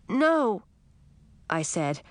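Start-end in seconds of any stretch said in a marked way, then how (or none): 0.1-0.6 a sharp, disappointed no. Acted. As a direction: snap it out, letting the disappointment show. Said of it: "making the word come out more sharply".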